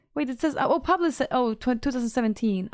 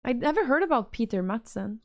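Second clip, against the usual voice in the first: usual speaking voice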